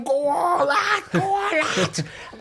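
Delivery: high chanting crowd, egging him on; mock crowd chant, high-pitched; crowd cheer. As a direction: voice the football crowd urging him on.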